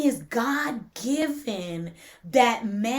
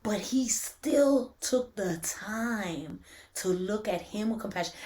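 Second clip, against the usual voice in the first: tender scripture reading; 4 semitones lower, 6 dB quieter than usual. Real, not acted.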